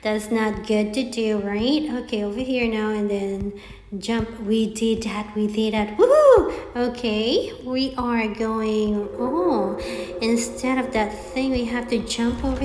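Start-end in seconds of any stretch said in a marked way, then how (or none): 5.9-6.8 high, loud victory whoop; sudden high celebratory woohoo; cheer. Real, not acted. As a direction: shout it out like you just won. Cheering after clearing a jump.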